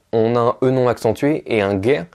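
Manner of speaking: normal talking voice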